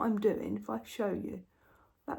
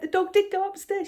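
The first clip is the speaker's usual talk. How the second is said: higher, louder mock disbelief; husband voice; 10 semitones higher, 6 dB louder than usual. Mimicking her husband's disbelief, higher and louder. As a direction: ham up his doubting question. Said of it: "And he went".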